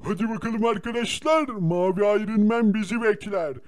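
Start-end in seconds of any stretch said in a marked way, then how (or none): none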